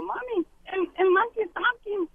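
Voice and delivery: put-on high-pitched child voice; little child. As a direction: pipe up like an amazed kid, slowing down. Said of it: "Y un niño dijo". (voz aguda de niño asombrado)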